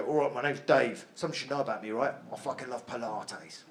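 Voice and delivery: low, quiet London geezer confession; London man's man. Acted. Geezer confessing his feminine side, low London accent. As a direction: confide softly in a deep London accent. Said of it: "a man with that accent".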